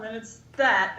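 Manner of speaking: usual speaking voice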